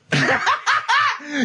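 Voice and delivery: high burst of helpless laughter; shrieking laugh. Real, not acted. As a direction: let out a squealing laugh. Cackling at the absurd question.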